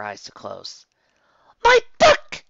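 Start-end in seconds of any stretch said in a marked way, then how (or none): 1.6-2.4 very high, loud anguished cry; dramatic yell. Acted. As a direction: wail it like a drama queen. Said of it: "Rarity yelled out".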